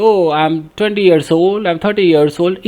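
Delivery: drawn-out mock reply; slow, playful example reply; slow example answer. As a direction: act out a student's answer slowly. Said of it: "कोई बोलता है".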